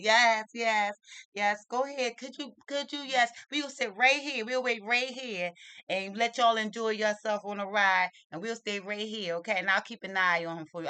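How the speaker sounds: high, loud, eager agreement; lady playing along. Lady offers to watch boy during ride, loud eager play-along. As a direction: play along with exaggerated helpfulness. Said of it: "She playing right in with it".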